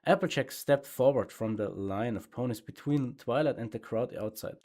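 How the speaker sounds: ordinary delivery, nothing marked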